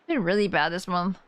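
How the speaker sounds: usual speaking voice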